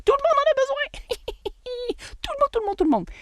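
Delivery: very high squealed caricature; silly high-pitched parody of excitement; mock enthusiasm. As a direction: squeal like a deluded seller, mockingly. Mocks seller convinced everyone needs it.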